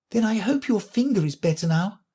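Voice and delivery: Alice asking kindly; very politely. A narrator's reading. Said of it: "Alice said very politely".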